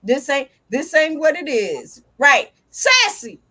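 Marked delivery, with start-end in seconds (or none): 0.0-2.0 lower, slow unimpressed dog voice; voicing the dog. Owner imagining her dog's unimpressed reply, low and slow. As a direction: speak for the dog, low and dismissive. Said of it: "You probably be like".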